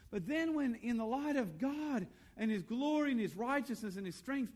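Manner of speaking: high, loud, awestruck preaching; fervent preaching. Real, not acted. Preacher telling congregation of God's majesty and might, fervent preaching.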